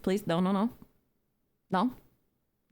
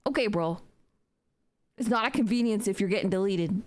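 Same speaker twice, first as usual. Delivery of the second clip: normal talking voice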